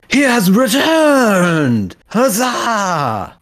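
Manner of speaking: high, slow, rousing cheer of a crowd. Acted. Tavern crowd hails returning hero, cheering. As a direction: cheer him home like a tavern mob.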